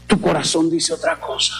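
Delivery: ordinary delivery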